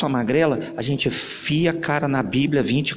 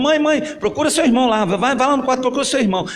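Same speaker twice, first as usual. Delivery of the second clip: higher, louder hurried brush-off; dismissive mother; 9 semitones higher, 4 dB louder, faster than usual. Acted. Mother sends the boy away to his brother, hurried and dismissive. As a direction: shoo the child off to his brother.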